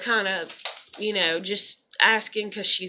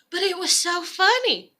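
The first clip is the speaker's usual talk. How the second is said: high-pitched amused confession; imitated amusement; 7 semitones higher than usual. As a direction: imitate a counselor giving in to laughter. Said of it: "She started laughing".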